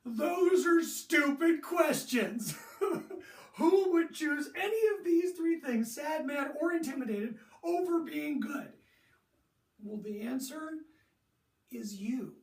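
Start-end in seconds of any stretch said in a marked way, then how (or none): 0.0-2.5 slow, loud scornful scoff with a laugh; hammed-up teen dismissing the question; imitating a student who calls the questions stupid; mock scoffing student. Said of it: "I know you're thinking". 2.6-3.5 breathy unvoiced scoffing laugh; imitated student laugh; mock scornful laugh. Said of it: "I know you're thinking". 3.5-8.7 put-on higher, louder disbelieving teen voice; imitating a player who finds the question stupid; mock incredulous student. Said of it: "I know you're thinking".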